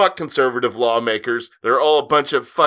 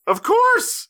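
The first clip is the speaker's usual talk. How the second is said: high mocking "of course"; sarcastic exclaim. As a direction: throw it up sarcastically.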